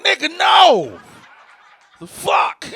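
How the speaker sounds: yelled high and loud at his friend; mock outraged shout. Yelling at his best friend over a dumb joke, loud. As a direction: bellow it in disbelief.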